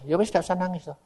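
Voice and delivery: soft, resigned agreement; subdued mock-obedient reply; meek acceptance. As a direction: give in quietly. Speaker meekly accepts God's order.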